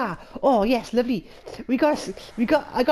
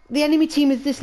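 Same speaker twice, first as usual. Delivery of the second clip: usual speaking voice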